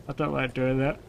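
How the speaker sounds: talking through a yawn. Real, not acted. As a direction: say it mid-yawn. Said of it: "in the middle of another yawn".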